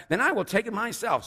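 high, loud hen declaration; high-pitched, self-reliant storybook hen; indignant storybook hen. A narrator's reading. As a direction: huffily announce it as the hen. Hen declares she will do it.

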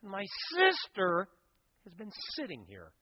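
high, whining complaint to Jesus; whiny Martha. Acted. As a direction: complain like a put-upon sister. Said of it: "She says".

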